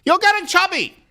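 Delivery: high, nagging parent voice. Retold or imitated. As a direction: scold the kid like a strict mom. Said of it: "his parents telling his sister".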